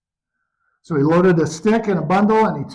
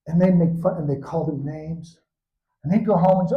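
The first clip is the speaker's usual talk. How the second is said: ordinary delivery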